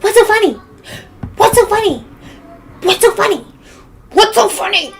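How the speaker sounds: high-pitched, loud mimicry of a confrontation; mocking neighbor. Neighbor confronting her from the car, mocking high voice. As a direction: throw it out high, like a mocked aggressor.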